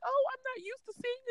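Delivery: high, sweet grandma imitation; doting grandma. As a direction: coo it like a tearful grandmother. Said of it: "she's like".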